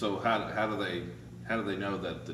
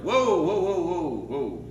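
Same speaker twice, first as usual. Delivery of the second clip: acted alarm; 7 semitones higher, 6 dB louder, much slower than usual. Salesmen facing farmer's shotgun.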